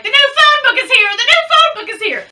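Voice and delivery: fast, high, giddy yell of delight; Steve Martin's Jerk. Retold or imitated. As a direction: squeal it like a man-child getting the phone book. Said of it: "He's like".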